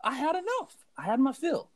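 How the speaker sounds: high, strained and half laughing, slowed at first; desperate plea. Retold or imitated. Beaten boy pleading with his buddy to stay, desperate high voice. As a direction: beg like a beaten kid at his limit. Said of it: "I'm like".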